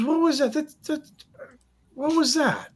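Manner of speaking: put-on very high, startled drunk voice; drunk patron. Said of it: "He goes".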